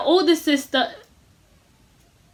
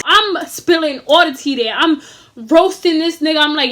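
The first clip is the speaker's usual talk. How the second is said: normal talking voice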